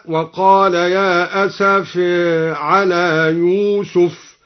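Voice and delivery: slow chant, "أسف" ending in a half-tilted vowel, asafe; taqlil. Shown as a demonstration. Reciter demonstrates taqlil on "أسف" in slow chant. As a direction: shade the last vowel of "أسف" between aa and e. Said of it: "وقلل قوله تعالى".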